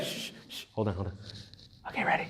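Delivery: hushed, sneaky whisper; devil whispering. Retold or imitated. The devil waits for the praying person in a sneaky whisper. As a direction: whisper like lying in wait to ambush. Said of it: "he's like".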